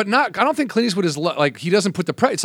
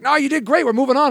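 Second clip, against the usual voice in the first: breezy director; 10 semitones higher, faster than usual. Retold or imitated. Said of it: "And they're like".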